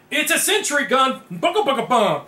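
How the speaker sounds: loud, high, gleeful announcement with gun noises; big playful burst of excitement; excited reveal. Pretend. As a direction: play-act a rattling gun. Excited reveal, imitates gun firing.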